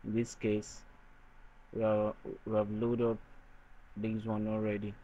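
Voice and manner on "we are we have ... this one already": normal talking voice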